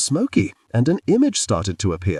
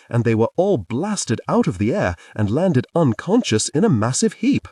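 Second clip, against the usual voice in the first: usual speaking voice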